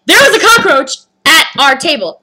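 loud, high, emphatic protest; insistent complaint. Retold or imitated. Customer telling cashiers about the bug, insistent complaint. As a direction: press the complaint home, punching each phrase.

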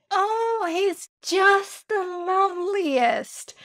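high, slow, gushing lady voice; gushing neighbour. Retold or imitated. Neighbour lady gushing about the cat, high and slow. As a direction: gush over the cat like a smitten neighbour. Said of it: "And she said".

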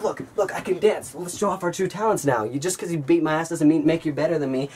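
high, sarcastic boasting; mock him while dancing; teen taunting the bully, high and cocky; showing-off taunt.

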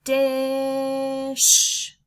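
loud drawn-out "Dish"; the blend "Dish" for the class; blended word. Shown as a demonstration. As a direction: stretch "Dish" slowly. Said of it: "Do it one more time".

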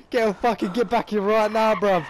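shouting at the missing mate; angry yell. Real, not acted. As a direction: order him back, shouting. Yelling at friend to return.

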